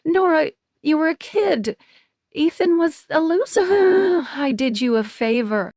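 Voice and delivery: high-pitched, cutting dismissal; icy. A narrator's reading. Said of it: "voice icy".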